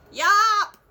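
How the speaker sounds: loud high yelp of a shout; one big shouted yop. Acted. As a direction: blast it out as loud as you can. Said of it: "he shouted out".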